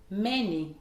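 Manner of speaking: MA stressed in "Many", then a fall; first-syllable stress. Shown as a demonstration. Teacher models a common word, stress on MA in "Many". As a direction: hit the start: MA-ny. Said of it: "l'accentuation sur la première syllabe".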